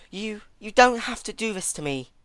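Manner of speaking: high, loud pleading; desperate plea. A narrator's reading. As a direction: beg her desperately to stop. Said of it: "trying her best to convince her".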